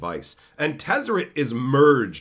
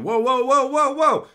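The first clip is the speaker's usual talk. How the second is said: placating whoa; 11 semitones higher, 5 dB louder than usual. Retold or imitated. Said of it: "with his hands up going".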